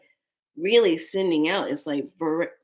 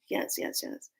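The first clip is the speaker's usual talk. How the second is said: whispered yes; 11 dB quieter than usual. Retold or imitated. Eager quiet agreement.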